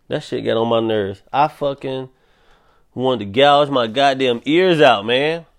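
ordinary talk, nothing marked

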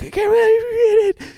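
high and cracking up; laughing. Retold or imitated. Rolling on the floor at his friend, laughing hard. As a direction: squeak it out through helpless laughter. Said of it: "laughing, crying".

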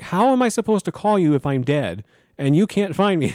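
incredulous. Retold or imitated. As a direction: ask it in disbelief. Incredulous reply.

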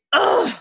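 high loud fed-up "ugh"; frustrated groan. Retold or imitated. Murtaugh groaning over Brianna's engagement news. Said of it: "so cheesed about this news".

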